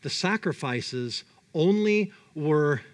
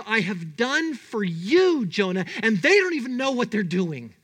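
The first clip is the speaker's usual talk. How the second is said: raised, emphatic, aggrieved appeal; impassioned rebuke; 4 semitones higher than usual. Acted. God reproaching Jonah after saving him, indignant. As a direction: press home everything you have done, leaning on "you".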